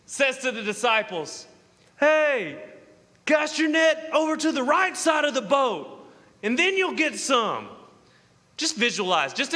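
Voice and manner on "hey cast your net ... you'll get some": raised, high calling voice; calling from afar. Acted. Jesus calling to disciples out on the boat, raised call. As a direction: call instructions out to a distant boat. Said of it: "from about a hundred yards away".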